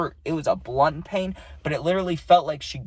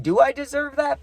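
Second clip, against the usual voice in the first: much higher, pressing the question; emphatic repeat; 11 semitones higher than usual. Real, not acted. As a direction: push the question harder the second time. Repeating the question more strongly.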